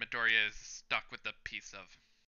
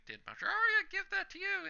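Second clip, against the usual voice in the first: put-on squeaky girl voice pitched way up; high Pinky voice. Pinky teasing Uraraka about the keychain, high squeaky voice. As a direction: ask it like a giddy gossiping girl.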